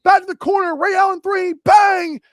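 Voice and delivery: high, loud basketball commentator call; excited sportscaster imitation; play-by-play announcer. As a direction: call the big shot, punching out the bang. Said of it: "the commentary that sits in your brain".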